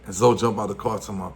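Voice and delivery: normal talking voice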